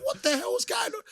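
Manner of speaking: totally thrown by the trick; mock bewilderment. Retold confusion on pitch.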